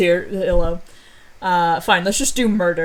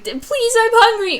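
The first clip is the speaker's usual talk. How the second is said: very high, pleading whimper; mock whine; 6 dB louder than usual. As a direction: whine like a starving kid. Whining hungry character.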